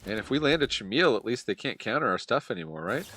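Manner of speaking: usual speaking voice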